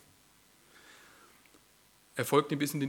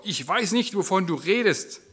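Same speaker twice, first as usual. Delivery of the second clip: higher, louder, flaring up; angry outburst; 7 semitones higher, 7 dB louder than usual. Acted. Peter cornered by bystanders, flaring angrily. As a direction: snap it out in a burst of temper. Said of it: "stieß aufgebraust hervor".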